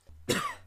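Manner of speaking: put-on raspy elderly coughing; old man's cough. A narrator's reading. Elderly Pegasus beside Dash, wheezy cough. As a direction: hack like an old pony. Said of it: "never stop coughing".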